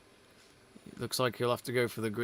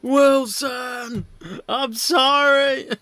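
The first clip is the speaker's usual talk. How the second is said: loud, high mock Tom Hanks yell; hammed-up castaway wail, much higher and louder; Tom Hanks shouting; 11 semitones higher, 9 dB louder, much slower than usual. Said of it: "gif ready of Tom Hanks shouting".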